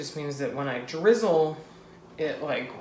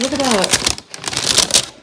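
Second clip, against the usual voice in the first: proud; 7 semitones higher, 7 dB louder, much slower than usual. Real, not acted. Proud of finished macaroons.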